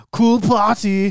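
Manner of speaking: a lightly done Arnold line; Arnold impression. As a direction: drag it out like a deadpan action star. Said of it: "which was".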